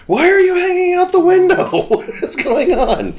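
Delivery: high shrieking question; mock panicked yell. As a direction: shriek at a friend leaning out. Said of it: "so I was".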